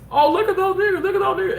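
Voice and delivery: high, mocking kid voice; taunting kids. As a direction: jeer like a bratty kid on a bike. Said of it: "they see us".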